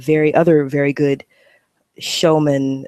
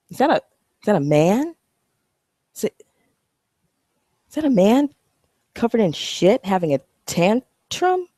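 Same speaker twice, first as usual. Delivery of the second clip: shocked disbelief; 6 semitones higher than usual. Pretend. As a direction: pitch shooting up in startled disbelief. Disgusted discovery on the street.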